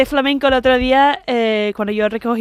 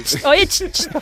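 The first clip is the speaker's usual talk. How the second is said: higher hissed summons, imitated; mock hailing with tongue clicks; as if summoning a dog; 6 semitones higher, 3 dB quieter, slower than usual. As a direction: call someone like you'd call a dog. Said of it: "como estás hablando a un perro".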